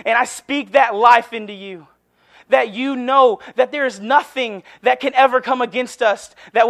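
strained hoarse preaching shout; loud, voice going hoarse. Real, not acted. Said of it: "I'm losing my voice and it hurts".

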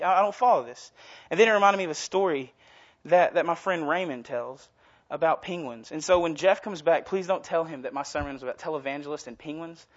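ordinary delivery

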